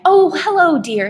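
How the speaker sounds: loud, high, sweet mum's greeting; cooing. A narrator's reading. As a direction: coo hello to your child. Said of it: "his mom cooed".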